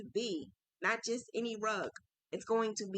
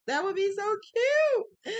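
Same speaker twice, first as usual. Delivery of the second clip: much higher and louder, slower squeal on cute; excited high gush; 11 semitones higher, 5 dB louder, slower than usual. Real, not acted. Crafter imagining a Christmas rug gift, gushing with excitement. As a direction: gush over the idea like a delighted fan. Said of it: "I'm getting excited".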